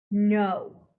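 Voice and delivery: loud, clenched refusal; through gritted teeth. A narrator's reading. Meg refusing through gritted teeth. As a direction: spit it through your teeth. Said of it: "Meg gritted her teeth".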